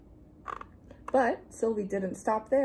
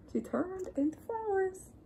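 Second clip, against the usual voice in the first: higher, softer sense of wonder; gentle wonder; 10 semitones higher, 5 dB quieter than usual. A narrator's reading. Reading to children, softly amazed at the flowers. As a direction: float it up lightly.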